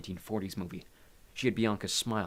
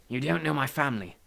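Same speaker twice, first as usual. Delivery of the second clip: higher, understated dark warning; 5 semitones higher, 4 dB louder than usual. A narrator's reading. Hades warns Maria about his family, darkly. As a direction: warn with a touch of darkness. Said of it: "Hades said darkly".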